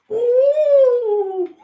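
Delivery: long, very high, loud "ooh"; piercing falsetto whoop on one note; high hoot. Pretend. Streamer hooting after a cheeky remark, high and loud.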